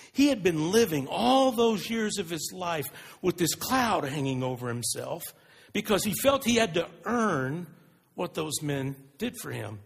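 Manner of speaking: ordinary talk, nothing marked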